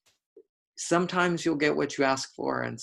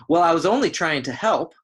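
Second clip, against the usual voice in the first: higher, louder acted protest; defensive protest; 6 semitones higher, 7 dB louder than usual. Wife defends herself to her husband, acted indignant protest. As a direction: play it hurt and defending yourself. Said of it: "she defends herself and says".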